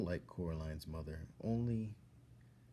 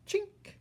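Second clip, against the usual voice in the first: clink of keys; 5 dB louder than usual. Acted. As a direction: ring it out like keys hitting the floor. Keys knocked down with broom.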